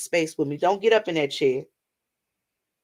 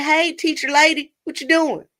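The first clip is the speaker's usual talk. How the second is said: put-on high, louder old man calling out friendly; old peanut man greeting; 10 semitones higher, 6 dB louder than usual. Old peanut man calls to the teacher at her door, high, loud and friendly. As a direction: cheerfully call out to the teacher lady from the door. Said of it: "He be like".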